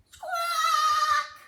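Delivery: very high, loud squawk; hen squawk. Acted. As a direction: screech like a hen. Hen's squawk.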